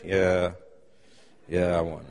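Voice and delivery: depressed voice. Retold or imitated. A kid reporting his race win, low and joyless. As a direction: mumble it like winning means nothing. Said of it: "That's not the way you behave".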